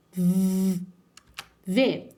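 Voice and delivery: "v" as a long, low buzz; sound of V. Shown as a demonstration. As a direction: hold the bare consonant v, no vowel. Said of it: "O som do V é". (som do v prolongado, vibrando)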